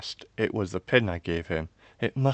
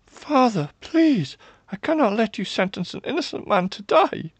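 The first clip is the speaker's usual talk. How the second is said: high, loud desperate appeal; pleading; 7 dB louder than usual. A narrator's reading. Daughter pleads for an innocent man's life, high and desperate. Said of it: "a pleading tone rose in my voice".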